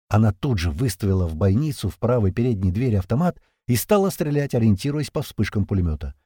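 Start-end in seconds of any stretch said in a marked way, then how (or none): none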